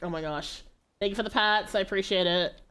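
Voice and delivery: ordinary talk, nothing marked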